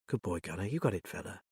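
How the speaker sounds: soft, tender praise; soothing. Acted. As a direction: quietly reassure the loyal dog. Soothing dog beside injured master.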